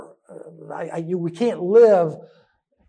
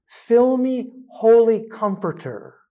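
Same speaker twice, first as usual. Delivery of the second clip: raised, fervent breath prayer; prayerful; 4 semitones higher, 4 dB louder than usual. Shown as a demonstration. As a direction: call it out as a prayer. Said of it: "let me give you one today".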